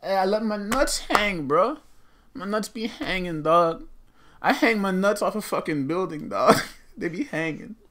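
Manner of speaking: high, punchy rapper-like boasting. Acted. Rapper bragging to his bro, high hammed-up boast.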